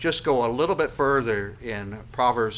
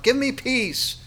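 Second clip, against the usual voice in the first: high, raised plea; crying out; 13 semitones higher, 4 dB louder, slower than usual. Acted. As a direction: call it out from the hilltop. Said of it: "he cries out".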